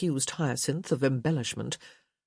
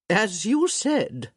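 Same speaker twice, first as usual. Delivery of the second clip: dry; 5 semitones higher, 5 dB louder, much slower than usual. A narrator's reading. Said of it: "Lady D said dryly".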